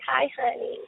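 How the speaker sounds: put-on high, sweet baby-voice greeting; little baby voice. Shown as a demonstration. Wife greets her husband in a high baby voice. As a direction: coo it like a little kitten. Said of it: "I got a little baby voice".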